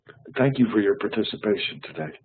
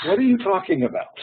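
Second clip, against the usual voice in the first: imitating wife; 8 semitones higher than usual. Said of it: "she'd say".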